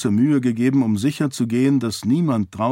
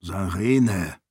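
slightly lowered, cautioning address; warning undertone; 3 semitones lower than usual. Acted. Father cautions his stubborn daughter, warning undertone. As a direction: say the name as a caution. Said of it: "mit warnendem Unterton".

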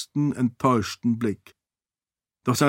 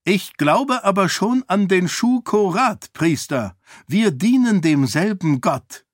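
higher, pressing voice in dialogue; character voice, raised; 7 semitones higher than usual. Acted. Woman argues faith with priest, higher insistent voice.

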